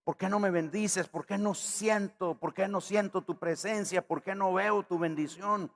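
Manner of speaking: high, pleading complaint to God; whining believer. Retold or imitated. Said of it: "nos preguntamos".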